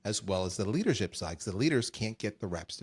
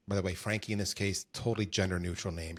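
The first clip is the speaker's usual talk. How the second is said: usual speaking voice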